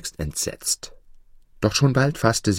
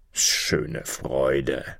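low, understated scoff; mocking; 6 semitones lower, 4 dB quieter than usual. A narrator's reading. Spirit scoffing at the mother's joy in children, mocking. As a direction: dismiss it with dry mockery. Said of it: "spottete der Geist".

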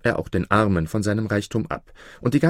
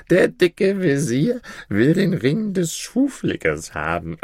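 put-on drunk; 9 semitones higher than usual. A narrator's reading. Tipsy Maruf mocks the fat vizier, drunk. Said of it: "in seiner Betrunkenheit".

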